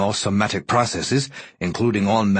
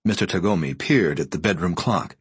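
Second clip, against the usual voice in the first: ordinary delivery, nothing marked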